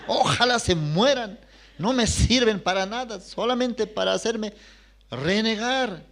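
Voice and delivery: put-on a worn-out mother venting at her kids; mother scolds her many children, mock exasperated. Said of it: "nos decía".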